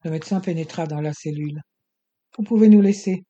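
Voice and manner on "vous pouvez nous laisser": louder command with weight on nous; ordering. A narrator's reading. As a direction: send the attendant out firmly. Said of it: "ordonna-t-il". (ordre ferme et plus fort)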